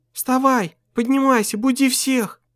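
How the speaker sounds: high, urging female voice; urgent woman's voice. Acted. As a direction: wake him up. Wife's voice urging.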